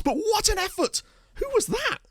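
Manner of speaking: very high, astonished commentary; amazed outburst. Real, not acted. Gamer reacts to a surprise effort, amazed outburst. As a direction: marvel at the attempt in a high cry.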